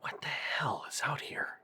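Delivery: unvoiced scared whisper; inner whisper. Alone in the dark woods, whispering to himself. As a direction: breathe it like a frightened thought. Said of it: "I was thinking to myself".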